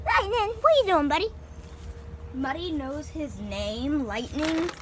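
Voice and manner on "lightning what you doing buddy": pet talk. Real, not acted. Owner cooing much higher at her guinea pig Lightning. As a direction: coo up high at the guinea pig.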